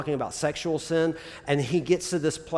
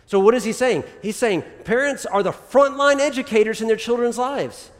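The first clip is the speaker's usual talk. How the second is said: rising preaching fervor; 6 semitones higher, 4 dB louder than usual. Real, not acted. Preacher builds to main point.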